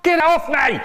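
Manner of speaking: high squealing yell; panicked high-pitched cry; shouted. Retold or imitated. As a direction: shriek to get them off. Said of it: "Me, I shouted out".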